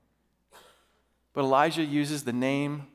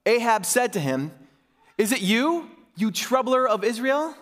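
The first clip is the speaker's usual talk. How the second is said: measured, emphatic public Bible reading; Scripture reading; 4 semitones higher than usual. A narrator's reading. Pastor reading Elijah's showdown to his church, emphatic Scripture reading. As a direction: read it out formally, phrase by phrase.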